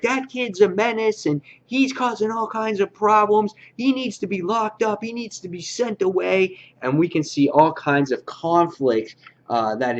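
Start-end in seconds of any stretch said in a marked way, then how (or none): 0.0-6.6 higher, mock-indignant parent voice; a light parody of fussing parents demanding punishment; outraged parents. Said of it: "And they're like".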